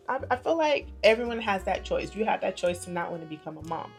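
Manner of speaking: usual speaking voice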